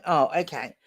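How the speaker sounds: ordinary talk, nothing marked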